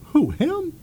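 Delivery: squeaky high disbelieving question; mock surprise. Mom asks the scout about the kid, high and disbelieving. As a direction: pitch it way up in disbelief. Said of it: "She's like".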